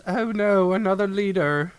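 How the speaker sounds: high fussy droid voice, up high; whiny protocol droid fretting; prissy robot lament; C-3PO impression. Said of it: "to which C three P O goes".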